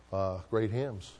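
normal talking voice

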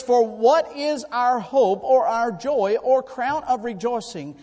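slow proclaimed Bible quote; scripture reading. Acted. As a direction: read it out like holy writ. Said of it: "Thessalonians two nineteen says".

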